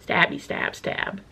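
whispered playful stab words; whispered slasher play; whispered stabby stab. Pretend.

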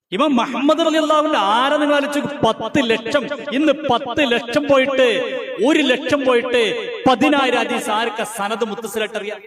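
high, loud preaching at full force; thundering sermon voice; fiery preaching. Acted. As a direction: build to a roaring pulpit climax.

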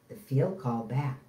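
usual speaking voice

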